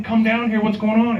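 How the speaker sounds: high-pitched mock calling out; retold yelling. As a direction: act out yelling up at a partner. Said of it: "I'm yelling at him".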